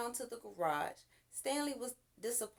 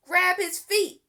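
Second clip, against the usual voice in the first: shouted; 9 semitones higher, 12 dB louder than usual. A narrator's reading. Mother yelling at Arthur to grab the feet, full voice. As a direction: top of her lungs, higher and much louder. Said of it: "shouted Missus Lamb Chop at the top of her lungs".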